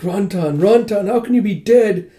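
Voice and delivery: hammed-up, high-pitched lament; mock wailing. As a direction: act out a mourner crying out. Lady drops to knees mourning.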